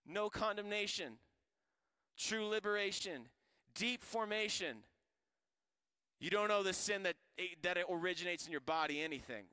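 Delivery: loud, high, driving preacher voice; preaching at full force. Acted. Preacher pressing the church at full force. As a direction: preach it hard to the congregation.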